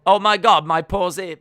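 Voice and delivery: ordinary talk, nothing marked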